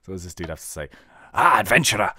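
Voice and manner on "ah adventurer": broad Scottish quest-giver, louder; hammy Scottish NPC voice; Scottish accent. Acted. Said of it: "He's Scottish as well".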